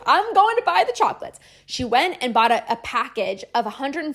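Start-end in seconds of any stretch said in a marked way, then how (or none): 0.0-1.4 bold high quote. Retold or imitated. Client's bold reply retold.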